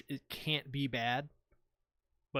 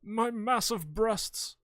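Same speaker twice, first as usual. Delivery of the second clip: slow, high theatrical finish; hammed-up melodramatic relish; Alex Louis Armstrong; 7 semitones higher, 3 dB louder, slower than usual. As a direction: draw it out with overblown feeling. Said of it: "Alex Louis Armstrong".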